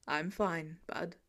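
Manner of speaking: low, soft, choked up; voice thick with emotion. Acted. Tony to Peter after being called Dad, voice thick and choked. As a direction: hold back tears on these words. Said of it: "voice suspiciously thick".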